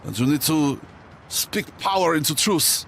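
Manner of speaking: put-on high, slow French accent. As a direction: play Emile, the French guy. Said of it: "Is a French character gonna come out".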